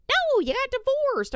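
high, loud, quick retort; exasperated rebuke. Acted. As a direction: cut in loudly with a high-pitched scolding. Mock rebuke to entitled divorced spouse.

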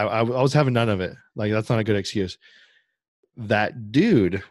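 usual speaking voice

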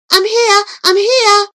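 high, loud answering cry. Acted. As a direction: call back in a hurry. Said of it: "she cried".

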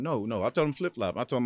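ordinary talk

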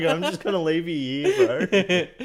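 mock mate ditching you, laughing; laughing mock quote. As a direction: say it cheekily while cracking up. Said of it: "It's like".

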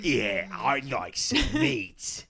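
slow, mildly voiced orc craving meat; a hint of a hungry orc; a light orc voice. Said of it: "It's from Lord of the Rings".

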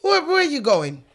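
Jamaican accent attempt. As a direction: call after someone in a Jamaican accent. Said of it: "Don't judge me on my accent".

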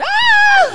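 high shrieking scream; mock scream. Retelling Peggy's fingers in the door, mock scream. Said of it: "She's going".

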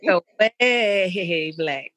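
drawn out. Pretend. Drawn-out playful sing-song brag.

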